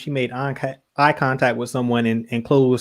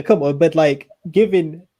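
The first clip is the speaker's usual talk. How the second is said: usual speaking voice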